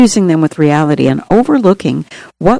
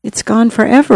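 ordinary talk

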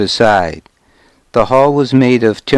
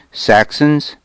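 higher, mildly surprised question; pretended surprise; 5 semitones higher than usual. A narrator's reading. Traveler hears news of Saxons, pretending surprise. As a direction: feign a touch of surprise, rising at the end. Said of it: "I pretended surprise".

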